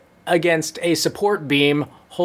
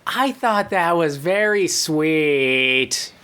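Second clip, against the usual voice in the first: slow, laughing dreamy judge; lightly voiced dazed judge through a laugh; much slower than usual. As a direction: drag it out, spaced-out and sweet. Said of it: "the reanimated corpse of [name] says".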